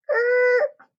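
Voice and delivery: high squealing "er" of a braking truck; a loud, high-pitched sound effect of the truck stopping; squeaky brake noise. Acted. Truck brakes sharply in story.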